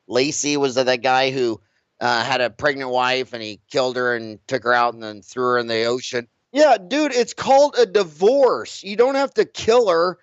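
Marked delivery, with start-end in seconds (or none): 6.5-10.2 sarcastic. Real, not acted. Mocking a man who killed his wife, sarcastic. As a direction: say it like it's obvious, dude.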